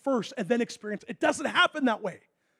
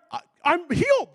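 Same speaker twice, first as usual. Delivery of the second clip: higher and louder, overjoyed; joyful shout; 9 semitones higher, 6 dB louder than usual. Retold or imitated. Healed player telling the medical staff, overjoyed. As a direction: announce it with glee.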